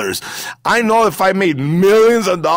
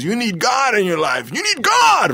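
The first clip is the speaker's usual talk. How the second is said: heckler's yell leaping up in pitch; mock shout, climbing higher on the repeat; imitated yelling; 7 semitones higher than usual. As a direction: mimic someone yelling at you after a show. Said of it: "some guy was yelling after".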